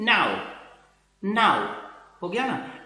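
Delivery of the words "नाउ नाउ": "नाउ" with an a to u glide; reading "now". Shown as a demonstration. Teacher showing the class how to read the word: gliding "नाउ". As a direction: say "नाउ" twice, a clear glide each time. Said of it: "वो कैसे पढ़ेंगे?".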